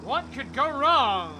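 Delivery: very slow, loud ironic drawl; sarcastic. Real, not acted. Sarcastic before risky jump.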